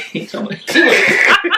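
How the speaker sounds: high laugh through the words. Real, not acted. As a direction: break into giggles while talking. Laughing mid-story.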